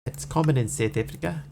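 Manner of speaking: mock South African accent; South African vowels, played up. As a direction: answer as a put-on South African. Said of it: "her accent as South African".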